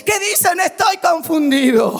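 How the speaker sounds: full-force pulpit preaching; fiery preaching. Acted. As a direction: preach it to the whole church.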